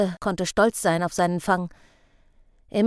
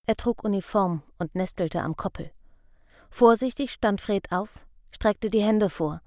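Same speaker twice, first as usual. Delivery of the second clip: plain reading voice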